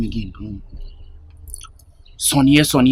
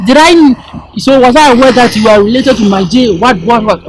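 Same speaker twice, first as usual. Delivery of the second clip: raised, higher voice for his challenge; retold confrontation; 5 semitones higher, 4 dB louder than usual. Telling Beta how he confronted him, loud mock challenge. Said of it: "he was like".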